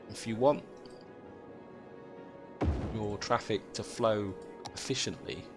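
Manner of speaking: usual speaking voice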